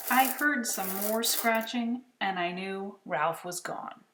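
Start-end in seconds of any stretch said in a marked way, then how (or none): none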